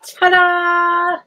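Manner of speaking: loud, high, held-out fanfare; triumphant reveal. Real, not acted. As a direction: sing it out proudly as you unveil it. Reveals what she is building.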